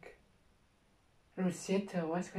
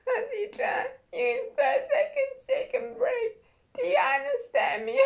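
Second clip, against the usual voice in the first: much higher, crying squeak of the detention line; squeaky mock principal; 8 dB louder than usual. Mocking the principal's detention order, squeaky.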